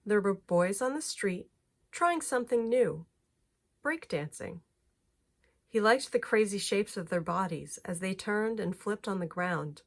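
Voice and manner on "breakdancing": ordinary delivery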